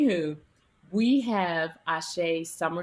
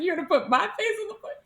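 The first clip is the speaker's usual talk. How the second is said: high, fast, amused disbelief; laughing disbelief; 12 semitones higher, faster than usual. Retold or imitated. Author hearing her face goes on the cover, laughing disbelief. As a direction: squeal it through a laugh. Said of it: "I said".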